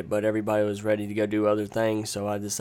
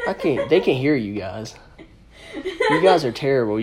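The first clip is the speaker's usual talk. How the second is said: laughing; 3 semitones higher than usual. Real, not acted. Host laughs at friends' interruption.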